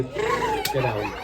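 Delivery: very high squeaky puppet yell; shrill puppet command; squawky puppet. Acted. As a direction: screech it like a Punch puppet.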